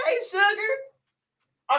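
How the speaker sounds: high, slow, drawn-out hello to a fan; excited greeting. Real, not acted. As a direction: sing out a sweet hello. Greeting a viewer warmly.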